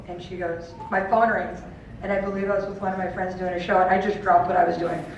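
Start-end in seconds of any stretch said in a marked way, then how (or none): none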